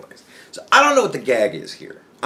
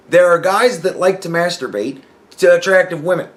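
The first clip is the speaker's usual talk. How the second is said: ordinary delivery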